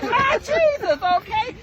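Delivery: very high, astonished "My Jesus"; amazed exclamation. Real, not acted. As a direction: gasp it out in disbelief. Stunned by the dancer clapping.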